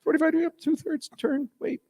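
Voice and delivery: high squeaky lecture on the pour; high mock lecture on pouring Guinness; high-pitched instructing. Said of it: "I'm like".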